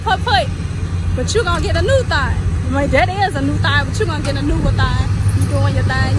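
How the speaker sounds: put-on high, loud teasing baby talk; teasing sing-song. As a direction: coo at her like a hurt child.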